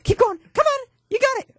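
high-pitched pet-owner encouragement; cheering a dog on. Retold or imitated. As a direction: egg him on like training a puppy.

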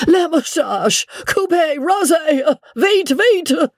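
high, rushed French orders; panicked master. A narrator's reading.

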